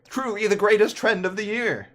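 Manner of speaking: high, loud mock enthusiasm; sarcastic mock praise. Vlogger mocking Fortnite in the rewind, sarcastic praise. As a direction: overdo the praise, not meaning it.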